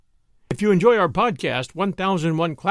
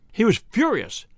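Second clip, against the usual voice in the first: plain reading voice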